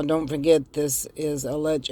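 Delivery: usual speaking voice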